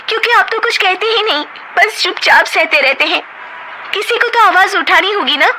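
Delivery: high, fast and exasperated; wife scolding her meek husband, exasperated; frustrated outburst. Acted. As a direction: push him to speak up.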